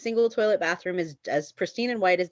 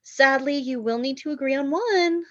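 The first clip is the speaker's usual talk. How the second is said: lightly voiced snooty saleswoman, higher; haughty shop owner; 5 semitones higher than usual. Saleswoman to bridesmaids, a light snooty voice. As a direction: announce it with superior pity.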